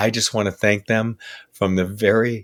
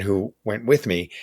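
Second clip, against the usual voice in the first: ordinary talk, nothing marked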